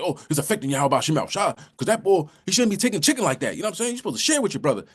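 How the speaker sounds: high, fast mock protest; hurried, high-pitched self-justifying complaint; making excuses. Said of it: "You're making excuses".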